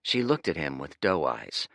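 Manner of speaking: plain reading voice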